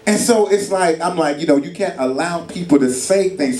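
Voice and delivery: ordinary talk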